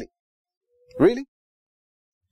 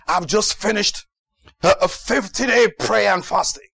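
raised, emphatic preacher imitation; boasting preacher; 4 semitones higher than usual. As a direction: proclaim it like a showy preacher, stretching the last words. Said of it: "He says".

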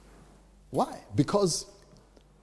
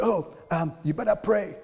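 higher, louder mock pious warning; 8 semitones higher, 4 dB louder than usual. People telling him to pray, mocking pious warning. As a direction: imitate a preachy busybody. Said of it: "some people say".

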